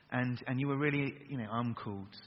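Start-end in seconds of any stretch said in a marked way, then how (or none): 1.5-2.1 a gloomy, low-energy mumble like Eeyore; mock Eeyore mumble. Shown as a demonstration. Preacher mocking the crowd's half-hearted answer, glum mumble. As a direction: say it flat and glum, no conviction.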